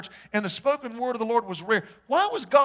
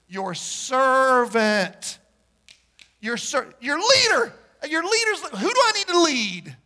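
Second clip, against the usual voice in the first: mock self-important; 4 semitones higher, 5 dB louder, slower than usual. Mock wrong version of prayer.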